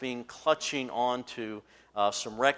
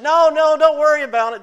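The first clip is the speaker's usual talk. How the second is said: much higher, loud breezy refusal; man refusing help; 18 dB louder than usual. Retold or imitated. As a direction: wave them off, far too confident. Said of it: "he said".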